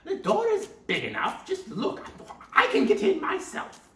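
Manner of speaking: high loud scornful witch; mocking witch. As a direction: sneer at the silly girl. Witch shows the oven.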